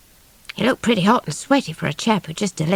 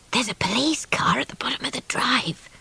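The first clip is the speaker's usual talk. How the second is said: hushed unvoiced whisper, voiced a touch higher; 5 semitones higher, 5 dB quieter than usual. A narrator's reading. Baby whispering the police car warning to the children, hushed. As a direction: breathe it urgently, no voice. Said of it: "poor Baby whispered".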